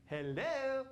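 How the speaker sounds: high, loud hello of disbelief; incredulous hello. Real, not acted. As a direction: throw it at them like it's obvious. Preacher challenges congregation.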